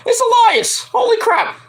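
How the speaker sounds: high-pitched, laughing exclamation; laughing surprise. Real, not acted. Fan reacts to Elias's surprise return, laughing in surprise.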